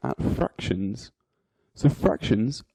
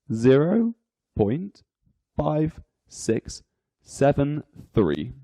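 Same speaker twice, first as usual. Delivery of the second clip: each word set apart by pauses; digit by digit; slower than usual. Shown as a demonstration. Teacher reading a decimal for learners, digit by digit. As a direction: read the decimal out one word at a time. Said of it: "each digit is read individually".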